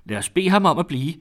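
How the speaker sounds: high, loud, wounded sarcasm; deeply offended. A narrator's reading. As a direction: exclaim it, stung to the quick. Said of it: "der var krænket på det ømfindtligste". (højt og dybt krænket)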